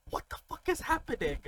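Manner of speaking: high, incredulous question; retold bewilderment. Acted bewilderment in story.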